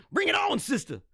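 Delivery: playful shout. Real, not acted. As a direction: call out the challenge with a grin. Challenging listeners, shouted.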